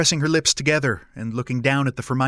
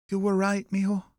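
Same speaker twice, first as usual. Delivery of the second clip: higher-pitched voice of a regretful mother; soft-spoken mother, pitched up; estranged mother; 7 semitones higher, 4 dB quieter than usual. Acted. Mother breaking the silence with her son, regretful.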